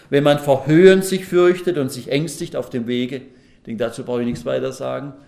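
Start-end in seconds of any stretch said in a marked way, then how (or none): 0.1-3.2 loud, declaimed verse; emphatic reading aloud, strong from the first words; scripture reading. Acted. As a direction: proclaim the verse to the congregation.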